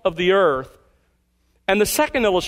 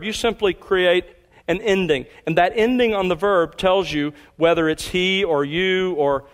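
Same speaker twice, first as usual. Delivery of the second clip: ordinary talk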